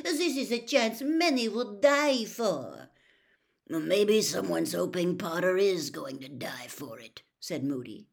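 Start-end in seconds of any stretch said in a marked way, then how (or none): 0.0-2.9 high, loud, petulant protest; heated, high-voiced complaint; indignant outburst. A narrator's reading. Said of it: "burst out Fleur Delacour, stamping her foot". 3.7-7.2 louder, faintly growled suggestion; understated growl; trace of a growl. A narrator's reading. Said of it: "with the merest trace of a growl".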